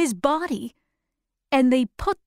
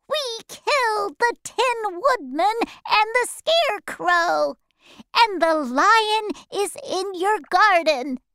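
much higher, strange creature voice; high monkey voice; 9 semitones higher than usual. Acted. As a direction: chirp the report like a flying monkey. Monkey reports to witch.

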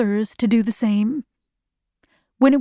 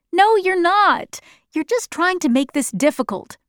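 higher-pitched exasperated objection; playful protest; 7 semitones higher than usual. A narrator's reading. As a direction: protest at being teased, pitch up. Child protests to teasing father.

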